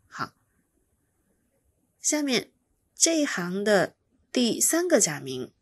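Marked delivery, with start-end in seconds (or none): none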